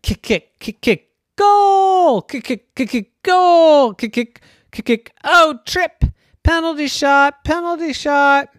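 high, loud playground chant; excited play-by-play. Acted. Kids shouting through a backyard soccer game, excited and loud. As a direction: cheer each kick like a match.

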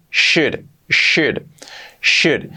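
"should" repeated as "shud", L silent; correctly pronounced should. Shown as a demonstration. English teacher drilling pronunciation for learners, "should" repeated clearly as "shud". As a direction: drill "should" as a separate word, with SH and no L. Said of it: "Importante la pronunciación".

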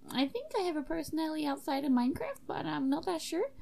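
high, hesitant character voice; awkward student host. Acted. [name] showing a guest the room, high awkward voice. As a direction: show off your room in a high put-on voice.

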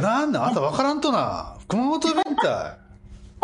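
mock-indignant Kumamoto dialect. Host teases co-host in broad Kumamoto dialect.

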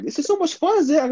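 put-on high excited girlfriend voice, pitched well up. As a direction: squeal it like his date having a blast. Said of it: "she going like".

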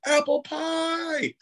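high, drawn-out mock cheer; imitating a thrilled crowd; excited group cheer. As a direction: stretch it out in a high, delighted squeal. Whole cast greets the pie.

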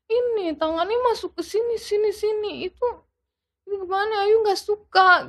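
high-pitched imitation of a little child; small child's voice. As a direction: voice a child's halting complaint. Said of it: "mungkin bahasa anak kecil gitu kali ya".

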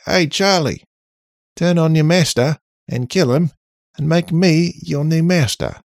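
mock stranger voice. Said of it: "random people on the street can't be like".

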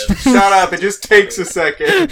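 loud, laughing, flustered; laughing protest. Real, not acted. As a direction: laugh it off, embarrassed.

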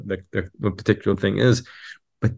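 ordinary talk, nothing marked

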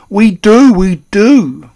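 high, very loud wail; anguished cry. A narrator's reading. Father admitting they own a cat, crying out. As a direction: cry it out twice in alarm. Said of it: "cried Mister Jenkins".

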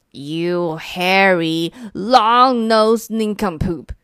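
second monster hurling names back; shouted insult. Acted. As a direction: spit each word of the insult. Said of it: "shouted the second monster".

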